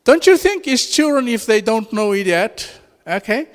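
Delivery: ordinary talk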